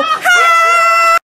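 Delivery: high, loud squealed "Hi"; mock greeting. Girls greeting the camera in a roast video, loud squeaky "Hi". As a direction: squeal "Hi" like a fake-sweet popular girl.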